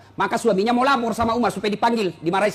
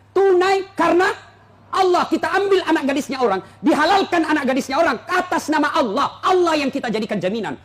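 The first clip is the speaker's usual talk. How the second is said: preaching at full force; 7 semitones higher than usual. Acted. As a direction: rise up and proclaim it to the crowd.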